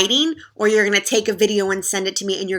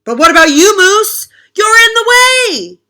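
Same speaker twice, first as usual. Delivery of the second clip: high, shouting complaint; loud protest; 11 semitones higher, 10 dB louder than usual. Acted. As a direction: shout up at the boss. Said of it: "friends protest to Moose".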